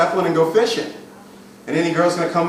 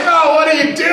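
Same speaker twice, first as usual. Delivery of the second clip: high, loud, hammed-up greeting; loud and oblivious; 12 semitones higher, 7 dB louder than usual. Coach acts boyfriend walking in on girlfriend, loud and oblivious. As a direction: blurt it out much too loudly. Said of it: "start talking loud about your day".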